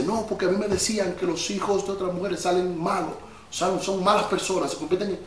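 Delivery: a hint of a scolding mother, held back; quietly mimicking mom's contempt; mother's reproach. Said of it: "me lo dijo como en son de reproche".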